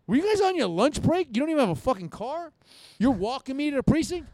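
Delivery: loud, high-pitched mocking questions; acted incredulous outrage. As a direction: replay berating the cops in disbelief. Retelling his taunting of arresting cops.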